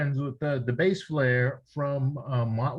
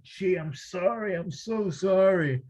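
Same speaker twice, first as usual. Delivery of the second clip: higher, slow, fake-sorry apology; mock apology; 6 semitones higher than usual. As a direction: pretend to be sorry, hamming it up. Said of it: "I was like".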